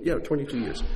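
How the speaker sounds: ordinary talk, nothing marked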